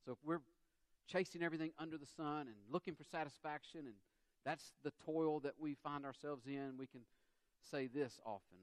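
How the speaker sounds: ordinary talk, nothing marked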